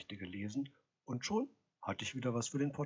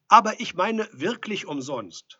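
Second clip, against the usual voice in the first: high, loud protest from outside; insistent calling; 9 semitones higher, 14 dB louder than usual. A narrator's reading. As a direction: insist loudly through the door. Visitor calls through the door.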